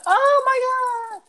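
very high, slow, drawn-out shriek; woman's shriek. Retold or imitated. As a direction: squeal it like a startled woman. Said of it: "she yelling".

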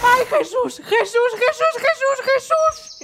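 high, loud panicked shrieks; panicked cry. Acted. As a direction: cry out in terror. Boy falls, grandma panics.

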